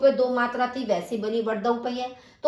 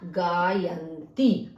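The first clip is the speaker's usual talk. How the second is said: slow sounded-out word; 4 semitones lower, much slower than usual. Shown as a demonstration. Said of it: "तो आपका बन गया".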